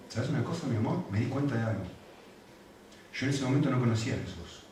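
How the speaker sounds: put-on soft, gentle voice to a child; softly calming his upset daughter; tender father. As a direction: soothe your little girl. Father comforts his crying daughter.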